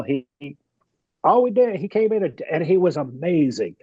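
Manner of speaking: normal talking voice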